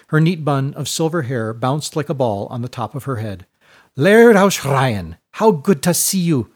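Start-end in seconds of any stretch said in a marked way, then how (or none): 3.9-6.5 a warm, louder hostess with rolled R's; shrublander rolling R's. A narrator's reading. Widow greeting the laird at the door, with rolled R's. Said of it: "with the rolling R's of a shrublander".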